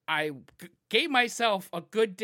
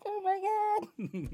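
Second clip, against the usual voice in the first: shocked bystander; 13 semitones higher than usual. Retold or imitated. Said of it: "sitting there like".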